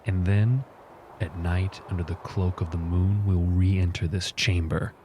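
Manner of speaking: slow, hushed, solemn pronouncement; in-character war leader. Acted. Leader telling warriors of the nightfall plan, grave and slow. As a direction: lay out the plan gravely, unhurried.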